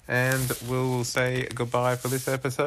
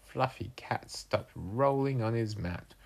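usual speaking voice

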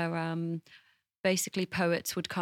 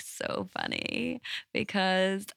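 put-on valley girl. Shown as a demonstration. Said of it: "That was good".

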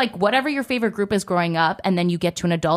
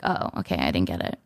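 crestfallen; 13 semitones lower, 5 dB quieter than usual. Retold or imitated. Retold reply to bad news.

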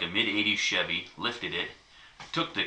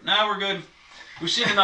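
higher and louder mock retreat; quoting themselves; 13 semitones higher, 7 dB louder than usual. Visitors back out of the stinking alligator hut, loud mock retreat. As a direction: back out fast, half laughing. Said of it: "we're like".

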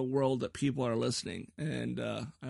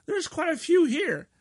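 much higher and louder, bursting with surprise; amazed; 13 semitones higher, 8 dB louder than usual. Real, not acted. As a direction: let the amazement jump out. Amazed at listener map.

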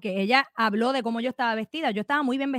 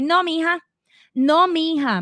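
condescending; 5 semitones higher, 4 dB louder, slower than usual. Retold or imitated. Imitating the legislator's condescension.

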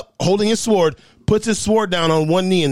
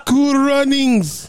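high, drawn-out mock Jamaican accent; 9 semitones higher, much slower than usual. Friend gives in after being pressed for the movie catchphrase, Jamaican accent. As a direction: stretch it out in a playful Jamaican accent. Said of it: "I wanna hear your Jamaican accent".

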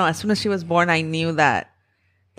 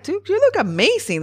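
gushing compliment. Retold or imitated. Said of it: "I'm like".

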